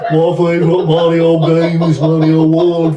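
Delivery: loud, high, slow Birmingham drawl; drawn-out comic Brummie; hammed-up Brummie, laughing; Brummie accent. Shown as a demonstration. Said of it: "pretty much like a Brummie".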